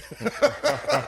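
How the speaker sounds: a sudden burst of high, loud laughter. Real, not acted. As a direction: laugh out at the irony of it. Laughing at the broom irony.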